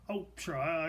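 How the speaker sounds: normal talking voice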